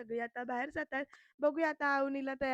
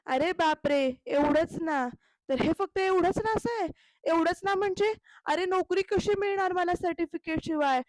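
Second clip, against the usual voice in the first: loud, higher, exclaiming in disbelief; shocked outburst; 5 semitones higher, 8 dB louder than usual. Retold or imitated. Raja shocked that she lost her certificates, loud outburst. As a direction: blurt it out, stunned and loud.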